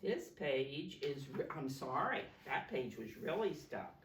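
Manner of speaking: plain reading voice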